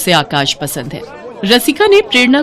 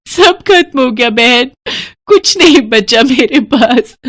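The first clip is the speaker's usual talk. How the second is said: put-on slow, higher, choked-up lament of a girl who lost everything; tearful grief; 5 semitones higher than usual. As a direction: let the words sink slowly, near tears. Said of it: "रो मत प्रेरणा". (धीमी, रुंधी आवाज़ में दुखभरा विलाप)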